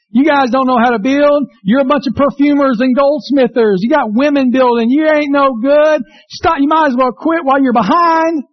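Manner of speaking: high, sneering taunt; mocking enemies. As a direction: jeer at the builders like a scornful enemy. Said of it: "they're saying stuff like".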